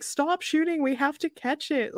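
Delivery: high-pitched imitation of shouting orders; mock shout. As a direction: play a frantic call without real volume. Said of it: "he's shouting at everyone".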